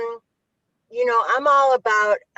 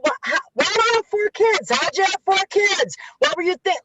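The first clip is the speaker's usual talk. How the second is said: much higher, scolding mock disbelief; mock judgmental questioning; 8 semitones higher than usual. Mocking how people judge young mothers, scolding high voice. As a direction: play the judgmental auntie asking about four kids. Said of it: "Let's not say".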